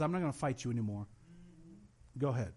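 lightly voiced weary God; God giving up. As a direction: let it go like a parent done arguing. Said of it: "God finally steps aside and says".

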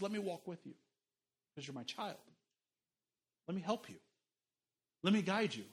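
soft, gentle reassurance; tender God voice. Acted. God comforts an overwhelmed child, soft and tender. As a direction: speak softly as a loving father.

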